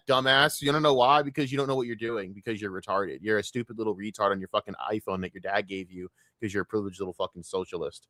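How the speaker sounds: ordinary talk, nothing marked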